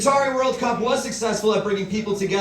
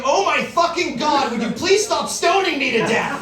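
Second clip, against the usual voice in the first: stoning victim voice; 6 semitones higher, 3 dB louder than usual. Retold or imitated. Voicing a person being stoned.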